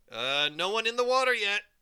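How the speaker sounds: high, loud warning call; teacher calling out. Acted. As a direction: call across the pool to stop them. Teacher calls out at pool.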